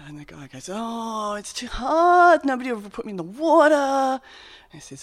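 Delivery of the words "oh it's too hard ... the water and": high, whining complaint, quick at the end; whiny sick man. Acted. Sick man to Jesus, whining he can't reach the pool. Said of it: "in a way we might tell a story at the pub".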